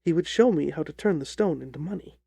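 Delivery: ordinary delivery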